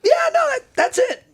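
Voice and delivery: very high, loud insistence through a laugh; excited insistence. Retold or imitated. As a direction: insist eagerly that you're right. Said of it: "And I was like".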